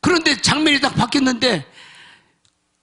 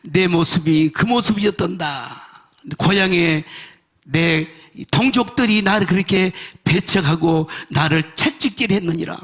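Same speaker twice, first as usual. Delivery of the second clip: low, measured retold divine voice; voice from heaven; 6 semitones lower than usual. The Lord speaks to the weeping man, solemn low voice. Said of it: "음성이 와. 아, 뭐라 하냐면".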